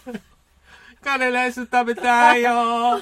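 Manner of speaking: =loud, high, hammed-up English-accented cry; shouted English-accented Japanese. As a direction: holler it in mock foreigner's English-accented Japanese. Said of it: "英語なまりの日本語で叫んだ".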